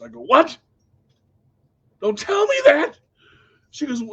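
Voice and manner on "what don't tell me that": startled, high yelp of alarm; alarmed what. Retold or imitated. Patient reacting to doctor's warning, alarmed yelp. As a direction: jump up in pitch and volume, alarmed.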